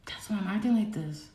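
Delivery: usual speaking voice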